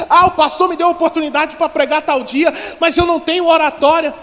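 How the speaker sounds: loud, high-pitched preacher's delivery; fervent preaching. Real, not acted. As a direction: drive each phrase home to the congregation. Preaches at full force.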